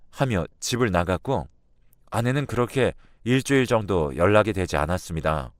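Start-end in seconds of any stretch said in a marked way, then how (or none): none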